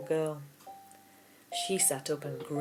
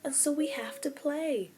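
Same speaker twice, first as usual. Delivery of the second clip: put-on excited little girl; 9 semitones higher than usual. A narrator's reading. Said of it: "she said".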